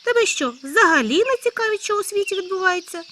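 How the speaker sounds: high loud disbelief; can't believe his friend hasn't heard; astonished hare. Acted. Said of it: "здивований заєць". (високе гучне здивування зайця)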